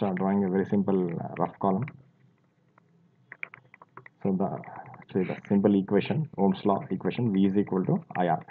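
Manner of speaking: normal talking voice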